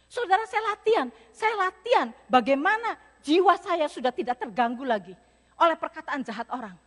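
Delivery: loud rising preacher's cry; fiery preaching. Real, not acted. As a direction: preach it out to the crowd. Preaching at full force. (khotbah berapi-api)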